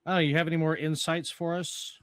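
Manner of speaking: normal talking voice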